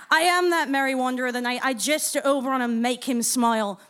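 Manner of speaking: middle-school Puck in a bad Irish-Scottish hybrid; terrible Irish-Scottish accent. Comedian recalls her middle-school Puck monologue, in Irish-Scottish accent. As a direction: recite in a muddled Irish-Scottish brogue. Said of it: "like an Irish-Scottish hybrid, terrible accent".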